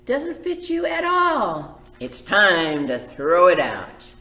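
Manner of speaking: slow, loud, firm verdict; firm mother. Acted. Mother telling Joseph to throw it out, firm. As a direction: lay down the law slowly.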